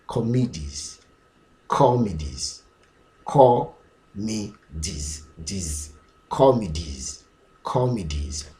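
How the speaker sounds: "comedies" as KAW-mi-deez, with "mi" in the middle; plural pronunciation. Shown as a demonstration. Teacher drilling the plural, measured and falling. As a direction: drill "comedies", stressed first, "mi" then "deez". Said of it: "When you come to the plural".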